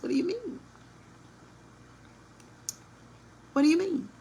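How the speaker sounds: high, small-voiced feigned puzzlement; mock innocence. Plays the woman feigning innocence.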